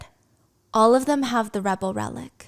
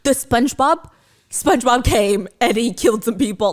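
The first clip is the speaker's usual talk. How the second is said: mock SpongeBob tale; 5 dB louder than usual. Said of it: "That's like me being like".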